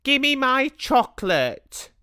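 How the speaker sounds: put-on Bruno, high and childish, slowly; a greedy boy demanding his chocolate; high boy's voice. A narrator's reading. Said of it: "he said".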